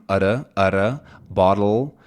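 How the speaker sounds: "udda" and "bottle" with a quick tapped d. Shown as a demonstration. Teacher demonstrating the American tapped d. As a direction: flick the tongue lightly on the d. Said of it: "this tapped D sound".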